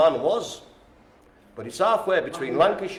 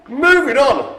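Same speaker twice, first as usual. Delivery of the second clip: laughing; 13 semitones higher, 4 dB louder, slower than usual. Real, not acted. Laughing at the spanner joke.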